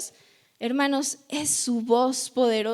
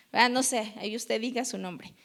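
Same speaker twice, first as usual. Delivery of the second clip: usual speaking voice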